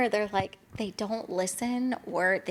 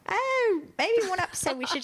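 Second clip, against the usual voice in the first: put-on British accent; 10 semitones higher than usual. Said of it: "Oh, I love a British accent".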